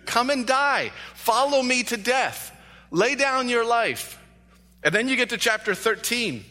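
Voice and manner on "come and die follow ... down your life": raised, higher, slower call of the shepherd; shepherd's call. Acted.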